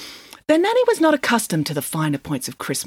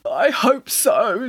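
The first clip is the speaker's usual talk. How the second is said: slow, high, teary quaver; tearful bear trying to be brave; holding back tears; 5 semitones higher, much slower than usual. A narrator's reading. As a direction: fight the tears as you speak. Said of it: "struggling to control his tears".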